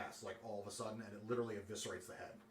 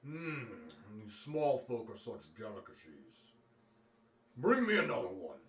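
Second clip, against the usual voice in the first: a dragon relishing its meal, then calling for more; gloating dragon; 5 dB louder than usual. Acted. Dragon to its captives, savouring and gloating.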